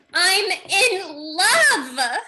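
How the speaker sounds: melodramatic. Acted. Irene's melodramatic declaration.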